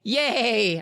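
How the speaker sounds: very high, drawn-out cheer; excited inner scream. A narrator's reading. Boy secretly cheering to himself, acted excited scream. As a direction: squeal a contained cheer. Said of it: "I screamed to myself".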